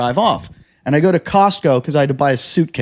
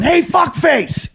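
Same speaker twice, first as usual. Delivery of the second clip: high, drawn-out call; mock angry holler; 12 semitones higher, much slower than usual. Driver calling after man on bike, high drawn-out holler. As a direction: holler the insult down the street.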